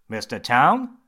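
bright, loud, cheery greeting; chirpy surgeon voice. Acted. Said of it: "he said in a bright voice".

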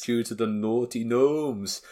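lightly put-on Scottish accent; slow, cheeky Scottish voice. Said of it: "I can't do a very good Scottish accent".

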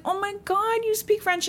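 high-pitched, gushing mom voice; excited gushing. Retold or imitated. Sam's mom to Henri about his French, gushing. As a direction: gush in a high voice, delighted and amazed.

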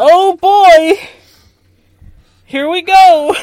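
loud, high gleeful cheer; excited. Real, not acted. Excited start of the rewatch.

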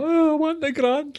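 high squeaky whiny voice; mock greedy whine; mocking a greedy friend. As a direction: whine for the money like a spoilt pal. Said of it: "They'd be like".

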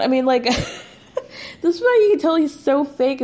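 high, fast and laughing through it; giggling disbelief. Real, not acted. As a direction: laugh while calling him fake. Laughing at the fake boyfriend.

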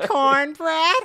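high, loud laughing shout; laughing disbelief. Real, not acted. Host reacting to the niece story, laughing shout. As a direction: shriek it through laughter.

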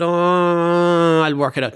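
long drawn-out "Oh", then an offhand brush-off; non-committal. Retold or imitated. Bernie answering a question about payment, non-committal. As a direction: imitate someone dodging a commitment. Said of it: "totally non-committal".

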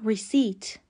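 ریسیت said "ri-SEET", the P silent; correct pronunciation. Shown as a demonstration. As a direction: drop the P in ریسیت, ending "seet".

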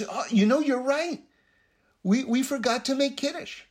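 high, animated mock dismay; feigned sudden realization. Second follower agreeing they forgot Kiddush, feigned sudden realization. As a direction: play up the oops, pitched right up.

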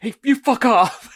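high, loud mock yell; mock shout. Retelling the film's running joke about obscenities, mock shout. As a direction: yell it as an imitated insult, on the edge of laughing. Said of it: "shouts an obscenity at his car".

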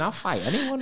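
high-pitched retold teenage bravado; mock defiance. Fifteen-year-old told he's not aggressive enough, mock defiant. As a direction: lightly act a young lad up for a fight.

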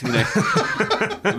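high-pitched laughing aside; real amused laugh as he speaks. Real, not acted. Laughs at the bloodbath line.

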